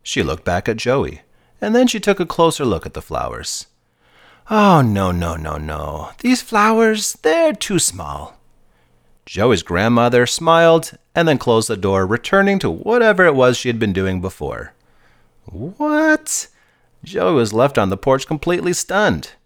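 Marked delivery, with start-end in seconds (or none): 4.5-8.4 grandma voice. A narrator's reading. 15.5-16.5 high stunned squeak. A narrator's reading. Stunned kid reacts.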